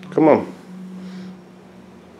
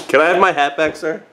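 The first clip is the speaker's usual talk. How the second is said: ordinary talk, nothing marked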